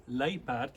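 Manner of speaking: luiperd said slowly as LAY-part with a rolled r; Afrikaans word. Shown as a demonstration. Teaching the class the Afrikaans word luiperd, said slowly. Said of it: "when you say".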